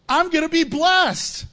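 high, drawn-out pretend delight; mock glee. Churchgoer facing beheading pretends to be thrilled, mock glee. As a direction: overplay being thrilled to be blessed. Acting out absurd glee at martyrdom.

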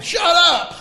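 sudden loud high "Shut up!"; yelling at a barking dog. Retold or imitated. Yelling at a barking dog at one in the morning, loud sharp shout. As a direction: bark it at the dog in the night. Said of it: "You're like".

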